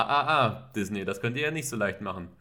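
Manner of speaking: ordinary delivery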